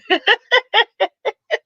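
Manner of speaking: high laugh. Real, not acted. Streamer laughing at her uncle's complaint.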